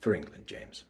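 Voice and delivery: hushed final taunts of a betrayed rival; mysterious bad guy. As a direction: quietly threaten a former friend, pausing between phrases. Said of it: "bad guy mysterious vibes".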